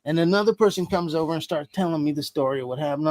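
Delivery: ordinary delivery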